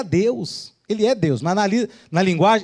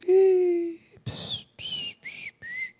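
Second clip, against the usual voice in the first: squealed "ih" then little pss sounds; thin, high imitated noise; soft high psst noises; high hissed "pss"; 7 dB quieter, much slower than usual. Imitated noise before mock quote.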